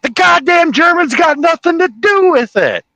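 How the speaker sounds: high, furious sheriff's shouting; Jackie Gleason. Acted. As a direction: blow up like a blustering lawman. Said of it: "I'm pretty good at doing Jackie Gleason".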